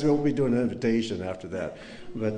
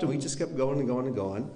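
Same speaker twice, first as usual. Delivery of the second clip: ordinary talk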